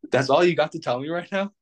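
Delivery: retold mock outrage, pitched high; exasperated what-the-heck, imitated; incredulous. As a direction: replay the disbelief, up high. Retold reaction to friend's lame advice.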